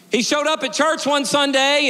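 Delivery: emphatic repeat. A narrator's reading. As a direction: ring it out as the amazing news.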